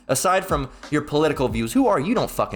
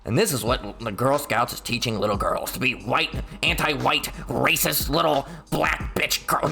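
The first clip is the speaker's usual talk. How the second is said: mock reading. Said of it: "It says".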